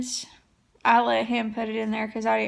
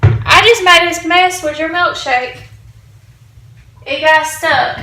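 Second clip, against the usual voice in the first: high, loud, half-whiny retelling of her own upset; playing her past upset self, pitched up; upset wife; 5 semitones higher, 10 dB louder than usual. Telling her husband about the spilt milkshake, higher and louder.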